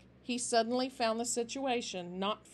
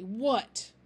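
angry; 4 dB louder than usual. A narrator's reading. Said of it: "Finch whirled around angrily".

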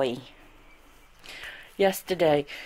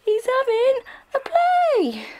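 high, drawn-out coo; excited pet talk; 3 dB louder than usual. Real, not acted. Gushing about the puppy at play, high cooing excitement.

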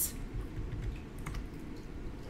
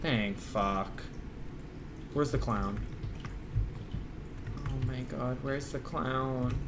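soft, low, wary murmuring; hushed; 6 semitones lower, 14 dB quieter than usual. Real, not acted. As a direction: whisper it while sneaking around. Hushed creeping after the scare.

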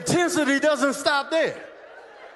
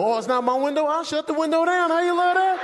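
indignant retort climbing steadily higher; petty indignation; 5 semitones higher than usual. Acted. Window passenger retorting to seatmate, increasingly indignant. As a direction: build from scoffing to high-pitched spite.

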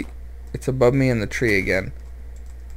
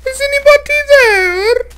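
thin ghostly high call; haunt the cave with a ghost voice; eerie high-pitched Is anybody there; spooky falsetto; 8 dB louder than usual. Pretend.

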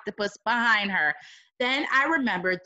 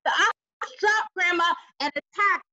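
plain reading voice

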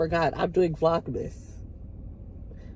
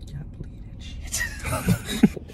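breathy laughing whisper of disbelief; 3 dB quieter, much slower than usual. Real, not acted. Husband scoffs to viewers, laughing under his breath. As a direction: whisper it while cracking up.

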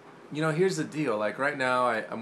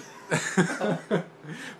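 high laugh; 6 semitones higher than usual. Real, not acted. Laughs at own expression.

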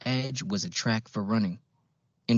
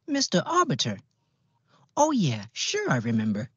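put-on "Mister Arbiter?" high and surprised in an old-fashioned Midwestern accent; a small receptionist in a folksy old Midwestern accent, much higher; 7 semitones higher, 3 dB louder than usual. A narrator's reading. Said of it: "old-fashioned Midwestern accent".

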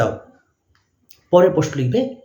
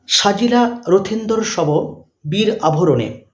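dictating; much slower than usual. Shown as a demonstration. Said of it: "লিখবে".

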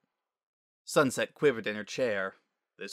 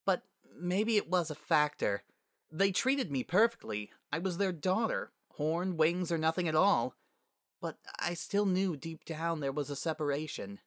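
put-on higher, earnest young voice; confiding Sunset; 6 semitones higher than usual. Sunset opens up to Twilight on the couch, confiding. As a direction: admit a long-held hurt, pitched up.

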